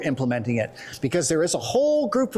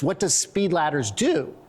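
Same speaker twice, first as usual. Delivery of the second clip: usual speaking voice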